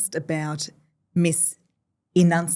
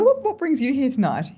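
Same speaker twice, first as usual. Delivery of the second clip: chatty bar opener; 8 semitones higher, 4 dB louder than usual. Retold or imitated. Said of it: "I said".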